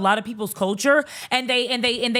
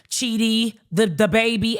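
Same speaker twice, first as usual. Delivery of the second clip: ordinary delivery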